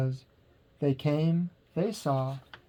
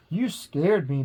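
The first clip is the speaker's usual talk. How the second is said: ordinary delivery, nothing marked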